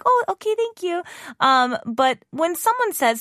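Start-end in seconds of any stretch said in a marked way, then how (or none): 0.0-1.0 meek reply. Retold or imitated. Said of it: "And I'm like".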